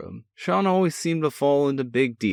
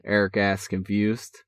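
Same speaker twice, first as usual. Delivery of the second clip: usual speaking voice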